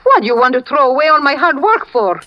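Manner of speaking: don't waste my effort, you fool; alarmed protest. Acted. Woman protests to the man about to leave, alarmed.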